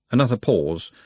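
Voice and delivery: usual speaking voice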